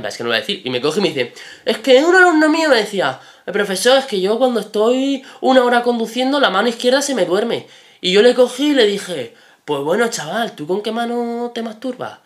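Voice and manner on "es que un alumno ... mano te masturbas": high, loud put-on instructor voice; hammed-up impression of a rustic teacher; imitated driving instructor. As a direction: retell as the odd village instructor, pitched way up. Acts out the instructor's anecdote.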